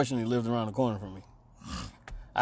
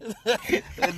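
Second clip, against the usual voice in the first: giggle; 6 semitones higher than usual. Real, not acted. Laughs mid-quote.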